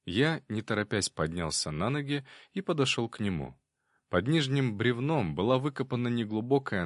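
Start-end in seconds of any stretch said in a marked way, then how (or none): none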